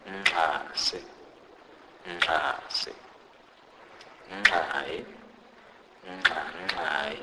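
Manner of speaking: "nxasi" and "nxai" with nasal clicks; Sepedi click demo. Shown as a demonstration. Teacher repeats the variants for learners, clicked "nxasi", "nxai". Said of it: "this sound is non-existent in English".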